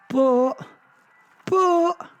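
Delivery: high, loud, separated "but"s; blurted objection. A narrator's reading. His compulsive objection bursting out.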